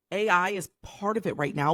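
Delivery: normal talking voice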